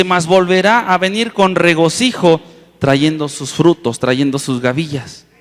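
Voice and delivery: loud, slow psalm reading; scripture reading. Acted. Preacher reads the promise of harvest to the congregation, loud scripture reading. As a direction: proclaim the verse slowly and firmly.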